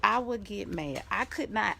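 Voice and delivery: ordinary talk, nothing marked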